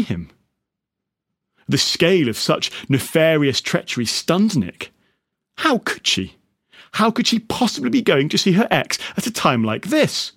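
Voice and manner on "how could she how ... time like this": rising, outraged disbelief; indignant outrage. A narrator's reading. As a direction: play up his jealous indignation. Indignant rant about widow.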